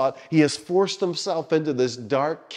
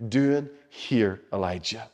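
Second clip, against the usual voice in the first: soft, very slow question, sinking low on the name; still small voice; 3 semitones lower, 5 dB quieter, slower than usual. Retold or imitated. God whispering to Elijah in the cave, a still small voice. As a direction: ask it gently and unhurried, fading away. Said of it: "he comes in the still small voice".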